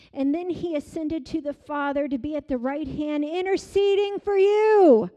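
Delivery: loud, high, rising preacher shout; preaching climax. Acted. Pastor exhorts congregation about the comforter, shouting. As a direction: build it into a fervent cry.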